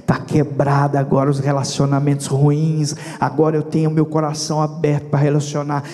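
loud, driving sermon voice; preacher pressing the point loudly; fervent preaching. Acted. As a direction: proclaim it to the whole church.

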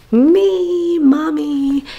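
high, loud, drawn-out announcement; excited reveal. Real, not acted. Mom showing her own family shirt, excited reveal. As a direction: present yourself with a big flourish.

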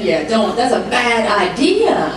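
ordinary talk, nothing marked

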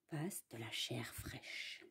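hushed suspense whisper. A narrator's reading. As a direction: whisper it like a secret. Suspense in the wolf story.

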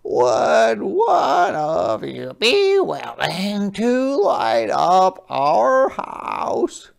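high, slow, gentle crab voice; a small polite sea creature asking; Hermit Crab voice. Acted. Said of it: "said Hermit Crab".

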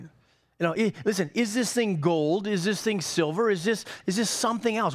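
ordinary delivery

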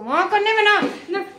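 amused laugh. Real, not acted. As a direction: laugh through the words. Laughing about the dog.

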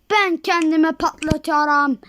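ordinary talk

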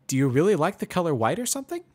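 high, loud and quick pony question; amused mare voice. Acted.

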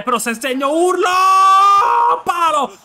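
high, loud shout of tension. Real, not acted. Gamer who swore not to shout, shouting anyway. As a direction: let the yell burst out. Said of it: "non posso urlare".

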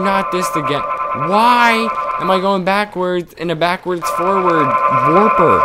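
high, whiny voice of helpless dismay; distressed game character. Acted. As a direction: wail in mock dismay, high throughout.

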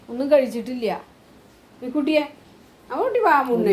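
high sing-song pet talk. Real, not acted. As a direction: coax the dog gently in a high voice. Comforting sad dog in rain.

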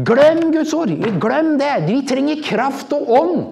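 imitating a zealot brushing off the Bible, high and loud; mock charismatic outburst. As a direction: shout it off like an overexcited believer. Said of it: "Jeg har vært med på, liksom".

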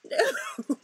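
high, very loud scream; hammed-up cry of protest; panicked refusal. Soldier refusing to jump on grenade, panicked.

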